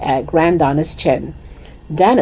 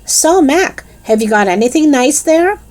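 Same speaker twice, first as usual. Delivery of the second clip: higher, bright called-out greeting; raised voice; 10 semitones higher than usual. A narrator's reading. Lisa calling out brightly to Mac, raised voice. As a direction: call over to him cheerfully. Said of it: "She raised her voice and said".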